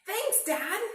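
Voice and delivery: high, bright, loud thank you; delighted thanks. Acted. Bear cub thanking his dad, high and loud. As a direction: thank him with a grin.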